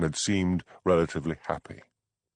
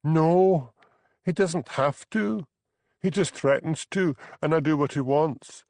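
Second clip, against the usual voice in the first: higher, light voice for Bella, desperate; young Highland wife, higher pitched, fed up; 10 semitones higher than usual. Acted. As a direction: play the trapped young wife pleading. Bella's lines in dialogue.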